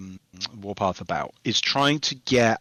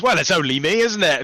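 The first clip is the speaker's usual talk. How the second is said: posh Melchett line; 6 semitones higher, 5 dB louder than usual. Retold or imitated. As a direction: deliver it like a pompous British general. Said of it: "I rewrote the dialogue as General Melchett".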